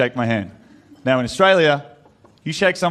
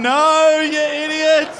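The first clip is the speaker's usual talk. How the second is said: his own brain berating him, high and exasperated; scolding inner voice; 11 semitones higher than usual. Retold or imitated. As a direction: yell at yourself in disbelief. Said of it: "my brain's gone".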